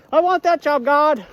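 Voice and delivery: high, loud call up to God; mock shouted demand. Joker demanding a job from God, mock shouting. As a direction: holler it upward in mock eagerness.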